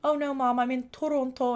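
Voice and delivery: put-on Toronto in a Korean accent, rounded vowels; switched Korean accent. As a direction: imitate a daughter switching into Korean accent for mom. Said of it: "switch the accent".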